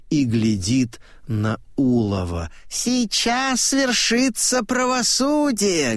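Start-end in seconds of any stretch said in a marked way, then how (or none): 2.7-6.0 very high-pitched declaration; high proclamation; old man proclaiming. A narrator's reading. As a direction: pronounce the verdict in a high, ringing old man's voice. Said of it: "говорит старик".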